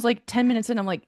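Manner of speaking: normal talking voice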